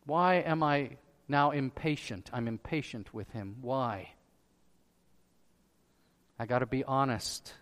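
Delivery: low, soft talking to himself; subdued self-questioning. Acted. Preacher acting out honest talk with himself, hushed. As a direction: drop low and admit it reluctantly.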